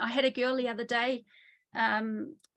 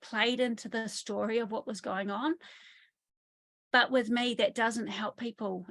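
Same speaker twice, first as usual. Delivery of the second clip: usual speaking voice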